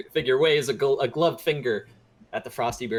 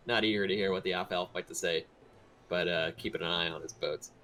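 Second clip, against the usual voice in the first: normal talking voice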